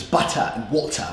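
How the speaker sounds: butter and water, crisp T, no final R, British; Queen's English. Retold or imitated. Teacher contrasting accents, imitated Queen's English. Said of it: "the Queen's English".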